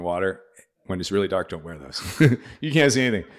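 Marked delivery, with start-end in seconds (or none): 2.0-2.5 short burst of laughter. Real, not acted. Laughs at own goggle story. 2.6-3.3 laughing. Real, not acted. Laughing at own mistake.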